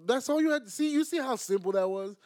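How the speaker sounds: high, loud exasperation; worked-up, incredulous telling-off; exasperated. Real, not acted. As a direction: scold a friend in disbelief, pitch jumping up. Exasperated at co-host's wordy boast.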